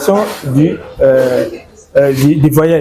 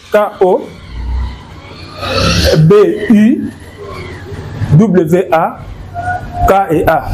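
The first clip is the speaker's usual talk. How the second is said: spelling aloud; 3 semitones higher than usual. Shown as a demonstration. Teacher spelling Kobwaka letter by letter for a student. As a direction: spell each letter clearly with pauses. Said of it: "j'écris Kobwaka comment".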